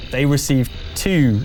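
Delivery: normal talking voice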